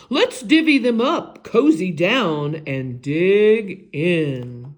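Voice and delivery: loud, eager badger invitation; grinning badger. Badger sharing honey nuts with friends, loud and eager. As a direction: invite everyone to dig in.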